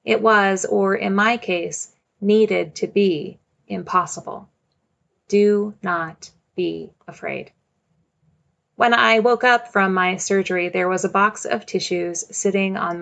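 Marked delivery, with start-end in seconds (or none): none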